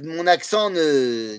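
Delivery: ordinary talk, nothing marked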